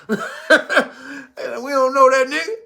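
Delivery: said slowly through laughter, high; laughing disowning. Acted. Backpedaling from the man, a slow laughing disowning. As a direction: laugh while disowning him, slow and drawn out.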